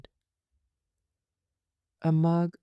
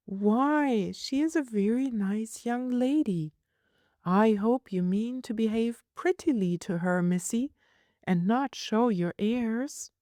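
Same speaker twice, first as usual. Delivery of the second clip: a touch of a fond, scolding nurse, a little higher; lightly voiced nurse; 7 semitones higher than usual. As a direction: play the nurse, mildly exasperated.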